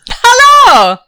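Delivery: high, drawn-out delighted hello; excited greeting. Acted. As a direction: greet him like you're thrilled to see him. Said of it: "He's very excited to see you".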